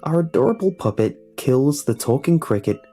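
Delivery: ordinary talk, nothing marked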